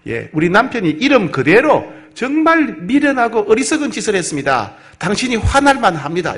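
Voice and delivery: Abigail pleading. Acted. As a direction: beg the angry general to calm down. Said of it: "이렇게 말합니다".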